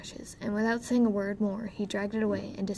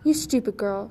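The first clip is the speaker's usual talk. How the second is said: snarled; 6 dB louder than usual. Acted. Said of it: "snarled the dwarf".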